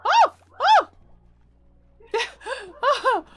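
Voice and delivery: high, loud delighted cries; excited squeals. Real, not acted. Streamer squealing at frolicking axolotls, delighted. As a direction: squeal with joy at the cute sight.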